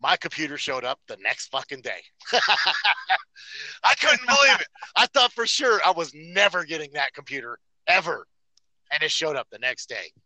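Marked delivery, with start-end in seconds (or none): 2.2-3.8 bursting into happy laughter; delighted laugh. Real, not acted. Delighted laugh at package arriving. 3.8-8.3 high, loud, thrilled disbelief; excited disbelief. Real, not acted. Excited about package arriving.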